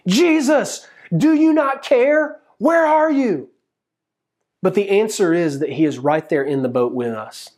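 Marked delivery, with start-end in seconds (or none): 0.1-3.5 high, pleading shout, acted out; desperate shouting. Believers crying to Jesus as life rocks, desperate shout. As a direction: call out desperately, pitched way up. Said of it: "we are shouting".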